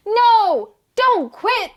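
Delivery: high, loud pleading crowd. A narrator's reading. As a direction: beg her not to quit. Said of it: "said the crowd".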